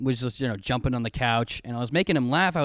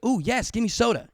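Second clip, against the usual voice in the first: higher, gleeful "Ooh, yes"; greedy eager kid; 9 semitones higher than usual. Shown as a demonstration. A boy at a sleepover asking for soda, gleefully greedy. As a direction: beg like a sugar-starved kid. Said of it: "And I said it kinda like that".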